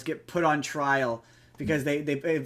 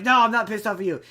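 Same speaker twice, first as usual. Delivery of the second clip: higher, louder mock-indignant denial; retold angry denial; 6 semitones higher, 6 dB louder, faster than usual. Retelling the mayor denying his anger, mock angry. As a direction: protest too much, lightly acted. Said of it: "like, he gets angry".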